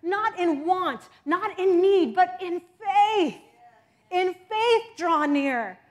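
loud, ringing sermon cadence; preaching at full force. Acted. Preacher lifting the church in loud proclamation. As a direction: proclaim each phrase to the congregation.

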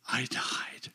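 soft whispered confession. Acted. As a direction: whisper the words gently. Quoting Jesus tenderly.

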